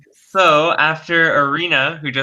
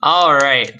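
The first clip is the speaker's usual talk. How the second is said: usual speaking voice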